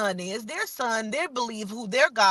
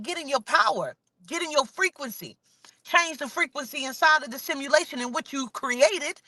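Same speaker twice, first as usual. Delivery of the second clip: ordinary talk, nothing marked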